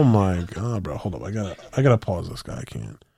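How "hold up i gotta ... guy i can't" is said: normal talking voice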